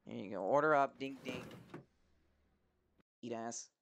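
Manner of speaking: normal talking voice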